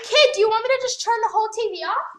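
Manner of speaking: scolding a little sibling, fast and loud; angry scolding. Real, not acted. Threatening sibling with TV.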